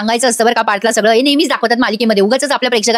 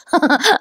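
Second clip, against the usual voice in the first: short laugh; 5 semitones higher than usual. Real, not acted. Narrator laughs at the plot.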